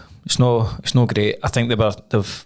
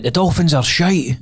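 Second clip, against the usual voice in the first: slow, higher put-on Scottish accent from an American pundit; mock Scottish accent; 6 semitones higher, slower than usual. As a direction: ham up a Scottish accent, dragging the words. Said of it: "like, a Scottish accent".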